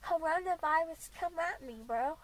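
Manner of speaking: ordinary talk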